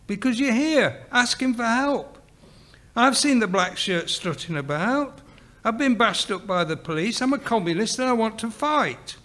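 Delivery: high-pitched youthful insistence; worked-up insistence. A narrator's reading. Sydney argues to join the fight, insistent. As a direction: plead your case, pitched well up.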